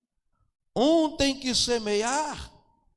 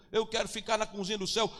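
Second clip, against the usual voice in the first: ordinary talk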